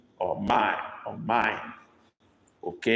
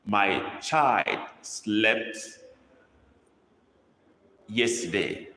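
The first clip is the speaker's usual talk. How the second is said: put-on My child slept said word by word, very slow; slow dictation; much slower than usual. Shown as a demonstration. Teacher dictating to the class, very slow and clear. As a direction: spell it out slowly for the class to copy. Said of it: "dictating a sentence to copy".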